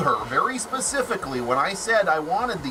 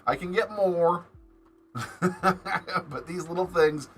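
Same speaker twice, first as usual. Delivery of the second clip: usual speaking voice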